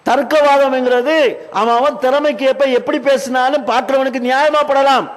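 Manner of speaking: loud, impassioned sermon delivery; full-force preaching. Real, not acted. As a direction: challenge the congregation at high volume.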